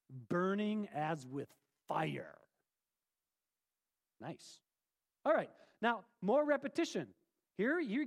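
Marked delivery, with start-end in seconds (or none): 0.1-2.4 slow and weighty, stressing Burning; the Hebrew meaning, spoken slow and grave; slow, solemn emphasis. Shown as a demonstration. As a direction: lean into Burning, slowly. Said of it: "That's the Hebrew for".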